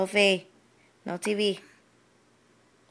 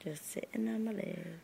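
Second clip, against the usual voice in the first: quick whisper; 8 semitones lower, 9 dB quieter than usual. Real, not acted. Parent whispering to baby.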